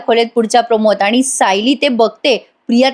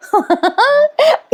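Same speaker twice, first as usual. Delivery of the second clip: short high giggle; high laugh. Real, not acted. Narrator laughing at Priya's drama, high giggle.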